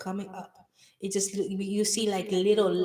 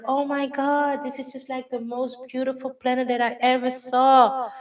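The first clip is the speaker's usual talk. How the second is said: raised, gushing wonder; amazed gush; 5 semitones higher, 6 dB louder than usual. Pretend. Guide voicing the listener's wonder at the planet, gushing high. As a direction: rave about the most beautiful planet.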